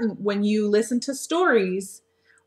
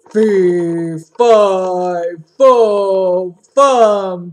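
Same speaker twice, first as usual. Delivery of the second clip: loud, booming ogre chant; 9 dB louder, much slower than usual. Acted. Teacher voicing the ogre for young listeners, loud booming ogre voice. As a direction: boom it out like a giant ogre. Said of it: "you make the voice of the ogre".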